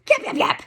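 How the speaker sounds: very high yap yap yap; high yapping bark imitation; imitated yapping. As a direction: yap like a small dog. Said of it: "my Cocker does whale eye".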